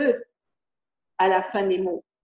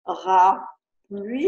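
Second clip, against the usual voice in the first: slow syllables; 2 semitones lower, 4 dB louder, much slower than usual. Shown as a demonstration. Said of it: "Est-ce que tu le vois".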